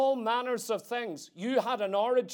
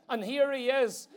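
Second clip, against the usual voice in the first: ordinary talk, nothing marked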